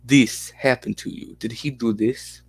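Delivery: put-on lightly Russian-accented interviewer; put-on Russian interpreter accent. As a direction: probe in a mild Russian accent. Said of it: "you like the Russian accent".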